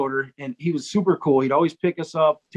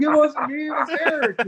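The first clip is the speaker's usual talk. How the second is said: high-pitched hasty denial; mock protest; 10 semitones higher than usual. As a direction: imitate the accused passing the blame. Accused bandmate shifts the blame.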